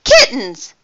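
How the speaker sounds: startled exclamation, pitched high; surprised cry. A narrator's reading. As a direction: cry it out in astonishment. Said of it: "cried O'Malley".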